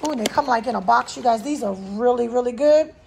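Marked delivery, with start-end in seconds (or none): none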